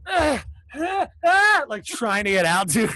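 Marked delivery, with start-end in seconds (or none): 0.1-1.6 high, rising tiny mock screams. Blueberries climbing out of baking muffins, high tiny screams. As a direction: squeal like a blueberry baking alive.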